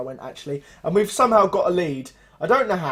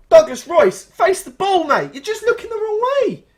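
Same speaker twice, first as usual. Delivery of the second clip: exasperated shout at player; 12 semitones higher, 7 dB louder than usual. Real, not acted.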